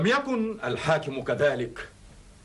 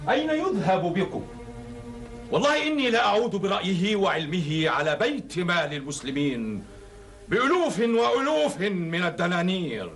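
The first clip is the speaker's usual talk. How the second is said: higher, fervent booming defence; impassioned exclamation; 6 semitones higher than usual. Acted. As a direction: cry out, then justify with fervour. Caliph exclaims in his own defence.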